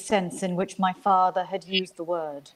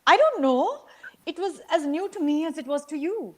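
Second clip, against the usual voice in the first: higher, a defensive mother pleading her case; a wife protesting to her angry husband, higher; mother's defensive voice; 7 semitones higher than usual. A narrator's reading. Mother answers in the quarrel.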